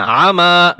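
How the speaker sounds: "আমা" with a long final vowel; lengthened vowel. Shown as a demonstration. Teacher repeats where to stretch, "আমা" held at the end. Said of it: "টান হবে".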